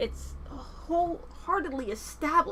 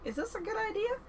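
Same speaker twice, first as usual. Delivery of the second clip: nervous doubt; 8 semitones higher, 6 dB quieter than usual. Acted. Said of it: "She's already kind of".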